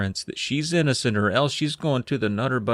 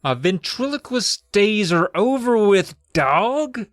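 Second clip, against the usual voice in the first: emphatic no; 6 semitones higher, 3 dB louder, slower than usual. Retold or imitated. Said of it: "Amy's like".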